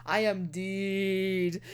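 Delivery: slow, low, dragged-out resignation; mock-tragic slow despair; doomed. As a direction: drag each word like it's over. Character resigns to doom.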